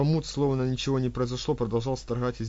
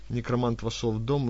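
ordinary delivery

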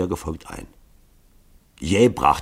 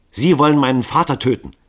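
higher, louder accusation; accusing; 7 semitones higher, 7 dB louder than usual. A narrator's reading. Grunja accusing Lycoville of wanting her father dead. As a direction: confront them with it.

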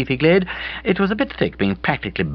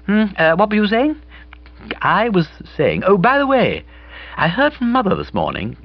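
put-on higher, brighter girl's voice; Bobby's voice; 12 semitones higher than usual. A narrator's reading. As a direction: play Bobby, lively and breezy. Bobby speaking.

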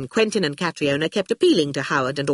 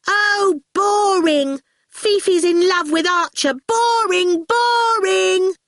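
put-on high-pitched child taunting; singsong bored jeer, drawn out; sudden exclamation; 13 semitones higher, 4 dB louder, slower than usual. A narrator's reading. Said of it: "Orphle suddenly exclaimed".

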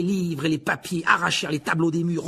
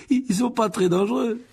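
choking with laughter; 9 semitones higher, 4 dB louder than usual. A narrator's reading. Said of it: "répondit Seamus en s'étranglant de rire".

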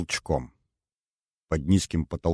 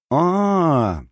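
long high knowing aah; 9 semitones higher, 4 dB louder than usual. A narrator's reading. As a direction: draw it out as if it all makes sense. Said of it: "глубокомысленно завела Светлана". (многозначительное «а-а-а»)

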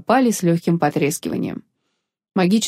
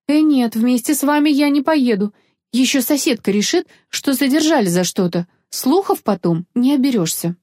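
higher-pitched character voice, protesting; lightly voiced objecting young woman; 6 semitones higher than usual. A narrator's reading. Young woman refuses to travel together, fearing gossip, protesting.

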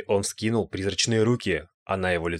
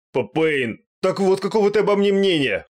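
stammering, high, loud complaint; hurt wail; 10 semitones higher, 5 dB louder than usual. A narrator's reading. Нос обижен словами Пейна. (обиженный высокий вопль)

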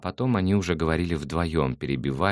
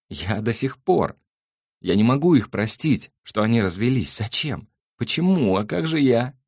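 put-on upset girl; 8 semitones higher, 6 dB louder than usual. Girl upset about parents' divorce.

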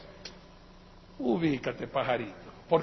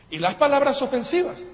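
"palabras ofensivas" said higher and louder, with a rising push on "palabras"; raised, pointed voice on "palabras ofensivas"; angry emphasis; 8 semitones higher, 6 dB louder than usual. Shown as a demonstration. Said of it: "uno no las dice con una sonrisa".

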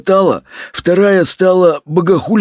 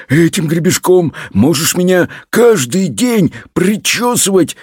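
usual speaking voice

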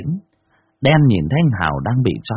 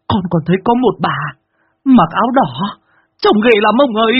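put-on high, loud, panicked child voice; a scared boy begging for help; stammering child; 4 dB louder than usual. Boy Tèo begging Phú Tý to save his master, high and frantic. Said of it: "nó lắp bắp".

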